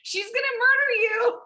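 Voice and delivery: very high, loud squeal; hammed-up high-pitched pet-calling voice; imitated partner calling cat. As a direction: mimic someone urgently coaxing a cat to safety.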